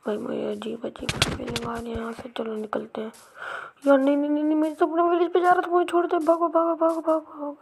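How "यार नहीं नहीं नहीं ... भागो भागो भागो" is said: high, hurried plea to be spared; panicked pleading. Pretend. Gamer begs an attacker to let him go, high and panicked. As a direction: beg to be let go, then urge escape.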